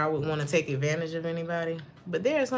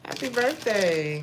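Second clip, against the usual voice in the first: ordinary talk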